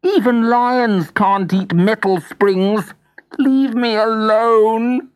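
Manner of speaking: scornful Jack. Acted. Jack scoffing at the lion, high and slow. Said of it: "said Jack in the Box".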